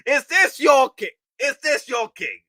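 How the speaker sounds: high, loud mocking challenge; mock Loki taunt. Streamer playing out Loki's taunt, high and loud. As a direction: throw the line out like a villain.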